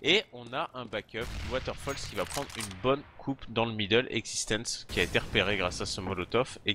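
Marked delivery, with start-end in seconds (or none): none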